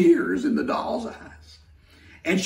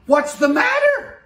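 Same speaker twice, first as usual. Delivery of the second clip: very high, loud little-engine voice; a tiny eager engine asks; 7 dB louder than usual. Acted. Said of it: "she said".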